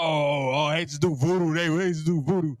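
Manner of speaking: normal talking voice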